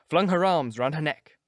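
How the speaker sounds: ordinary delivery, nothing marked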